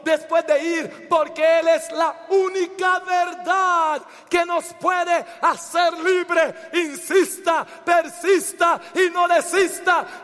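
high, forceful preaching rising to a shout; exhortación a gritos. Real, not acted. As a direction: urge the congregation on, building to full cry. (arenga ferviente, cada vez más gritada)

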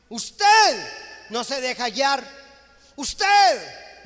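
loud pulpit rebuke, rising on usted; fiery preaching. Acted. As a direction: scold the flock with full pulpit force. Preaches at full force.

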